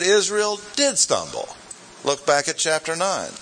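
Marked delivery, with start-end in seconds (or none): 0.0-1.5 DID lifted high to affirm it; stress on DID. Shown as a demonstration. Teacher countering what Paul did not say, stressed DID. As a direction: hit DID with raised pitch, falling on "stumble". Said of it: "In fact, he said".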